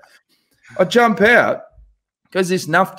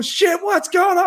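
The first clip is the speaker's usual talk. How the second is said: panicked shout; 13 semitones higher than usual. Retold or imitated. Panicked shouting mid-skydive.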